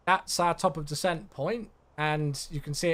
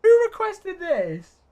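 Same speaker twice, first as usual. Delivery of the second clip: drawn-out outraged question; 13 semitones higher, 9 dB louder, much slower than usual. Real, not acted. Outraged at awful song request.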